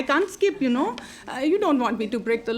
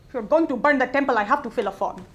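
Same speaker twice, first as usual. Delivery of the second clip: usual speaking voice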